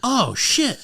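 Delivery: high-pitched mock dismay; comic startled swear; alarmed realization. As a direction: re-enact an oh-no moment through laughter. Alarm goes off on him.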